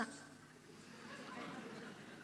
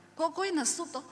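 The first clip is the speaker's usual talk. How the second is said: Javanese husband asking; 6 semitones higher, 3 dB quieter than usual. Retold or imitated.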